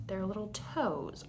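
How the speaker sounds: usual speaking voice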